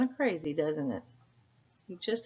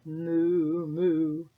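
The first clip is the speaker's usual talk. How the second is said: loud moo; 5 dB louder than usual. Acted. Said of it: "Now no cow said".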